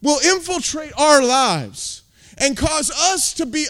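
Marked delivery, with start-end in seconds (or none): 0.0-2.0 building louder and higher; rising shout against the devil; fiery escalating sermon cry; angry preaching. Real, not acted. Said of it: "I'm angry".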